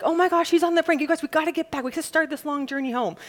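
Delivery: high, fast mock panic; hurried, alarmed mock worry; panicked disciple. Disciple panicking about the long journey home, high fast and alarmed. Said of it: "He's not like".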